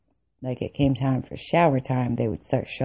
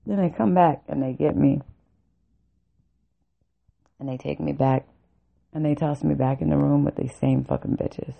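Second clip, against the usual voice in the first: normal talking voice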